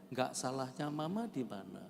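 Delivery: normal talking voice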